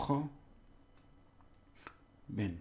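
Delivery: joven split as "kho", long pause, "ben"; syllable by syllable. Shown as a demonstration. Teacher breaking the word down, syllable by syllable. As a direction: leave a gap between the two syllables of joven. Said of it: "break them down into syllables".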